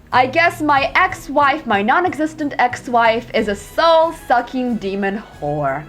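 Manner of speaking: ordinary talk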